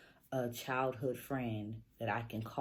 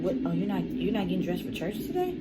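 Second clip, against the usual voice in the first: higher, louder, quick questioning; raised-voice retold disbelief; surprised mom; 7 semitones higher, 9 dB louder, faster than usual. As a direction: imitate a mother caught off guard. Retelling mom's surprised question.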